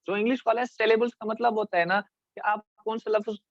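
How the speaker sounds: ordinary delivery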